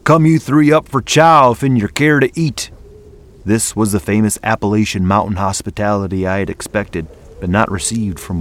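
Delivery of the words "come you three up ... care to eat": loud, higher holler. A narrator's reading. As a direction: holler over the shoulder. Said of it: "holler over his shoulder".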